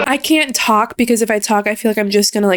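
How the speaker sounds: ordinary talk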